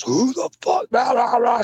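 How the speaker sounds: high mock yell of a furious driver; retold scream. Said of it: "started screaming".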